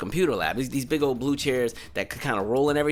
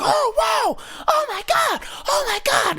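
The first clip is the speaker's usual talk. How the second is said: put-on kids freaking out; slower than usual. Kids seeing a boy collapse, very high panicked squeals. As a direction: shriek it like scared little kids. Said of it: "all the kids are freaking out".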